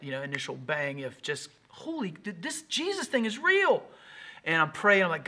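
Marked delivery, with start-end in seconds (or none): 1.7-3.8 high-pitched, drawn-out amazed outburst; super-spiritual excitement. Acted. Pastor acting out his new-convert awe, high and amazed. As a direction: play the awestruck new convert, voice leaping up. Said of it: "you get super spiritual after that".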